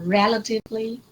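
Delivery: relatively stressed on REL, with short vowels; correct first-syllable stress. Shown as a demonstration. Teacher modelling for a student, relatively stressed on REL. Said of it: "don't say relatively".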